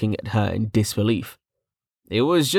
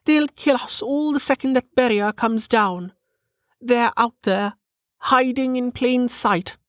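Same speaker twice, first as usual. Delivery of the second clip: high-pitched, testy lady; high, mannered aristocrat warning; alarmist noblewoman; 14 semitones higher than usual. Acted. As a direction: insist on the danger in a high lady's voice. Narrator voices Lady Parr, testy.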